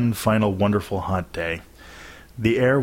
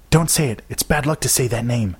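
higher-pitched urgent hushing of a friend; urgent warning; 4 semitones higher than usual. A narrator's reading. Girl stopping her friend naming the fairies, urgent warning. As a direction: cut them off with a warning.

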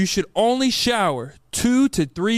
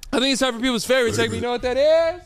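show host announcement; 4 semitones higher than usual. Acted.

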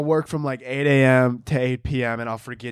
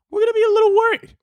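put-on very high, fast, louder babyish voice; cutesy voice; 5 dB louder, faster than usual. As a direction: play the worry down cutely. Cutesy worried voice.

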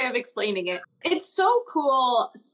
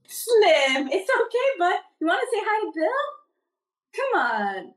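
talking to the dog; 7 semitones higher than usual. Real, not acted.

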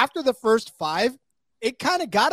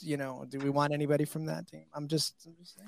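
quiet aside; 3 semitones lower, 11 dB quieter, faster than usual. Real, not acted. Joking aside about the team.

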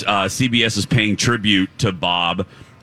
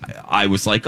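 usual speaking voice

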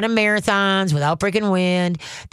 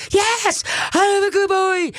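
much higher, slow, giddy talking dog; excited dog voice; 14 semitones higher, slower than usual. Retold or imitated. The dog answering its praise in a high giddy voice. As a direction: voice the overjoyed dog. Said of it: "what does the dog do".

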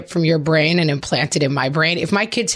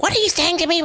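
high, indignant demand; mock confrontation; 11 semitones higher, faster than usual. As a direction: challenge him like you're ready to fight. Confronting son in car.

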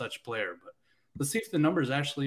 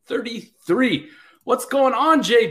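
high, loud guest welcome; excited greeting; 10 semitones higher, 11 dB louder, slower than usual. Real, not acted. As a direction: cheer the guest in.

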